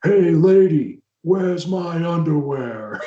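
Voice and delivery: big, slow, drawn-out holler; mock yell. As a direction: bellow it across a crowded room, stretching the words. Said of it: "and yells".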